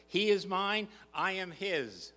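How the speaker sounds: loud, high declaration; preaching at full force; proclaiming. Real, not acted. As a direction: ring it out to the whole church. Preacher declares then repeats softly.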